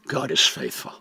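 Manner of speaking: ordinary delivery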